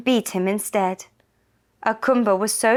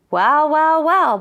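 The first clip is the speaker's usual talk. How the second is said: higher, louder gloating well well well; louder, higher greedy musing; scheming greed; 8 semitones higher, 7 dB louder, slower than usual. A narrator's reading. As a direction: savour the idea like a greedy schemer.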